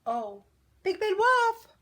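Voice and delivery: high, loud, drawn-out holler; calling out. A narrator's reading. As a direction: call after someone walking away. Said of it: "called Missus Duck".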